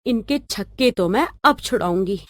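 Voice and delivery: quiet, calculating inner monologue; scheming aside. Acted. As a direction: plot to yourself under your breath. Scheming daughter-in-law's private aside.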